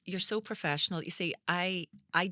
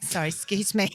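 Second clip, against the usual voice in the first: a little louder, more pointed second try; raised, apologetic nudge for attention; insistent excuse me; 3 semitones higher, 4 dB louder than usual. Retold or imitated. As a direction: politely insist on getting their attention. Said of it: "so I tapped him".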